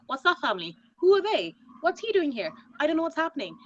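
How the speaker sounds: high, bewildered string of questions; lost TV viewer, voice climbing high; confused viewer. Retold or imitated. Show-only viewer confused by Game of Thrones, high and bewildered. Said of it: "they're like".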